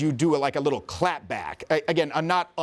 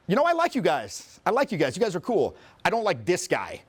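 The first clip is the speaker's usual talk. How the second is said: lightly voiced girl, pitched higher; imitated girl; 4 semitones higher than usual. Girl telling the group whom she likes, lightly imitated. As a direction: mimic her with a higher, pointed lilt. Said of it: "one of the girls, she goes".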